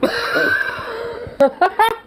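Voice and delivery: high, wheezing laugh; incredulous laugh. Real, not acted. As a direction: burst out laughing in disbelief. Laughs at the clip's question.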